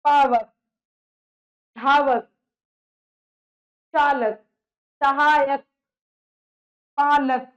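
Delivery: teacher calling out example words with the ending stressed; emphatic word list. Shown as a demonstration. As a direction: recite the examples loudly for the class. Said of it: "अक की ध्वनि आ रही है".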